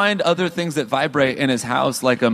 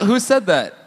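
higher-pitched, hammed-up questioning cry; mock startled demand; 7 semitones higher, 4 dB louder than usual. Playing startled accuser.

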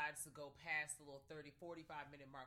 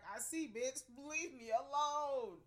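mock scared; 8 semitones higher, 8 dB louder, much slower than usual. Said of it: "I'm like".

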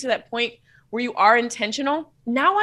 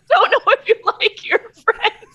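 high, slow words through laughter; real laughter; 9 semitones higher, 6 dB louder than usual. Real, not acted. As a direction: crack up while repeating the line. Repeats the line while cracking up.

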